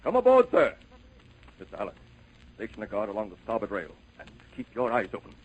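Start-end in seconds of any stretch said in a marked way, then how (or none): 0.0-0.7 high, loud shout to a boat below; calling out. Acted. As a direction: holler the invitation across the water. Captain calls down to the rowboat.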